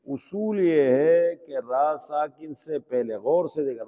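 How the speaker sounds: ordinary talk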